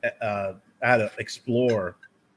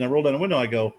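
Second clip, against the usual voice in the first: usual speaking voice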